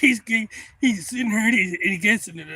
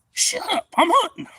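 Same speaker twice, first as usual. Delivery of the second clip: high, loud acted rebuke; irritated yell; 10 dB louder than usual. Hunter hollering at his stuck buddy to shut up, irritated yell. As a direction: yell it sharply, pitched way up.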